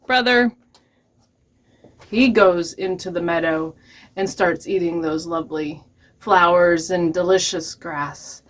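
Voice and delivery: ordinary delivery, nothing marked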